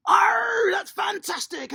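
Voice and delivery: loud high pirate shout then praise; hammed-up pirate whoop; excited pirate cheer. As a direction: whoop along with the kids' pirate cry. Coach cheers kids' pirate call.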